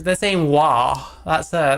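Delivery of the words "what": "wa" for "what", said loud in Scouse; Scouse-style "wa", punched out; Scouse pronunciation. Shown as a demonstration. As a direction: quote a Scouse "wa" with the final t dropped. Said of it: "that's a Scouse thing".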